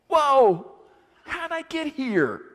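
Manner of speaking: loud high gasp of dismay; shocked realization. Acted. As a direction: caught off guard, voice jumping up. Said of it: "Peter realizes himself".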